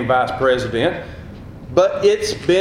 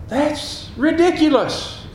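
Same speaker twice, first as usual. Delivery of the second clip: high, drawn-out disbelief; incredulous; 11 semitones higher, slower than usual. Retold or imitated. Preacher recalling his reaction to lottery machines, incredulous.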